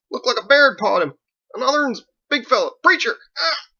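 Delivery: very high, loud squealing through pain; yelping in pain. Acted. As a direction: babble desperately while your scalp is torn. Drunk's hair twisted, in pain.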